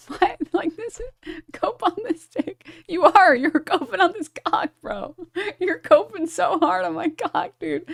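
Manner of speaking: high-pitched, laughing mockery; laughing taunt. Real, not acted. Laughing while mocking a chatter.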